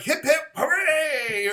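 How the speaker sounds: high, loud, drawn-out hooray; celebratory cheer. Acted. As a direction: lead a mock crowd cheer. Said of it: "It's almost like".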